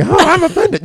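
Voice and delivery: high squeaky mock offense; a shrill-free but very high mocking whine; mock offended listener. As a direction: squeal it like a prissy complainer. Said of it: "there's people listening".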